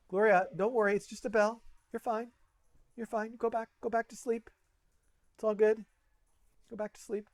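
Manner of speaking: soothing the dog. Real, not acted. Soothing the startled dog Gloria.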